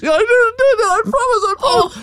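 put-on high, wailing child protesting through tears; imitating a crying seven-year-old pleading innocence; child howling. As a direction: sob out the denial as a little girl. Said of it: "She is howling".

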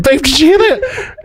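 high-pitched, giggly retelling of amazement; astonished aside to partner. Retold or imitated. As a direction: squeal it to your partner in mock disbelief. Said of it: "I said".

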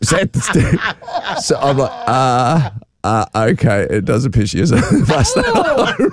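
laughs through a sheepish admission; high, giggling confession; laughing sheepishly. Real, not acted. Dad laughing as he concedes the daughter was in the bus lane.

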